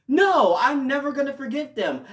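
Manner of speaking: high, loud cry of refusal; outraged protest. Real, not acted. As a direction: protest loudly like a wronged fan.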